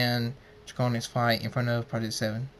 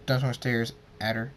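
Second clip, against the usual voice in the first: usual speaking voice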